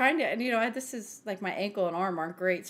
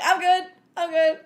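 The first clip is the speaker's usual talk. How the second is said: higher, louder quick reassurance; embarrassed reassurance; 7 semitones higher, 7 dB louder than usual. Retold or imitated. Card in toilet, reassuring coworker mildly loud. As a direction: brush her off fast. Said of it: "I'm like".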